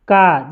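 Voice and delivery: का with a long aa, falling away; pronunciation demo. Shown as a demonstration. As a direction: sound out का clearly for learners. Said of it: "क्या होगा?". (का, आ को खींचकर)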